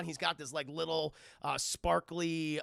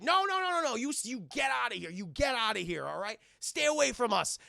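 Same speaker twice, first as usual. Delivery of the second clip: raised, loud acted tirade; angry banishing; 5 semitones higher, 5 dB louder than usual. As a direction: imitate someone furiously ordering him off. Man driving robot away.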